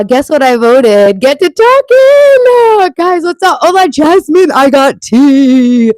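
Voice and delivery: high, loud, drawn-out whoop; excited shouting. Real, not acted. Streamer hyping up the chat, excited shouting. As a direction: call out with big, stretched excitement.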